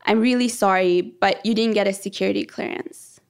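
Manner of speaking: ordinary delivery, nothing marked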